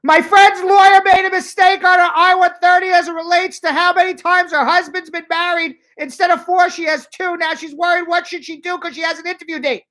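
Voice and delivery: reading a viewer's all-caps question as a hammed-up scream; mock screaming. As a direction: shout the question like it's all capitals. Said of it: "So she's screaming it at me".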